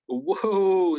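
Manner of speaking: retold alarmed whoa; startled objection. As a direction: act out cutting someone off in protest. Retold objection to false accusation.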